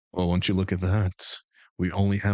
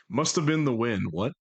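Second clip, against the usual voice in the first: ordinary talk, nothing marked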